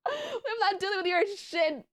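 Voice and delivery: high voice breaking into laughter; laughing refusal. Real, not acted. Streamer retelling a spat with Cap, laughing. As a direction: laugh helplessly through the retort.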